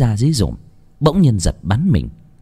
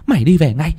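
scolding mother; 6 semitones higher, faster than usual. A narrator's reading. Mother scolds child home.

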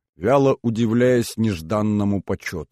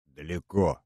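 grumbled; 4 semitones lower, 6 dB quieter than usual. Acted. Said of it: "буркнула Синтия".

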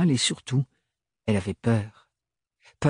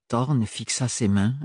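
ordinary delivery

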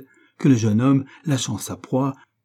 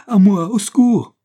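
loud, high desperate call; cry for help; 10 semitones higher, 9 dB louder than usual. A narrator's reading. Giulia appelant Beppo à l'aide, cri désespéré. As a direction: scream the servant's name for help. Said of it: "cria-t-elle".

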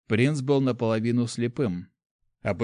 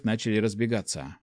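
usual speaking voice

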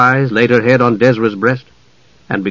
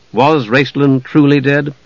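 ordinary delivery, nothing marked

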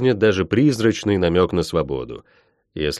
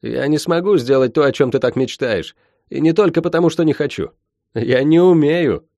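defiant; 6 semitones higher, 3 dB louder than usual. Acted. Shen defies the witch.

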